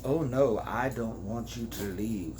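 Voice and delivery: low, softening man's voice; quiet low reassurance; suave male lover. Acted. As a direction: drop low and soft, coaxing her to stay.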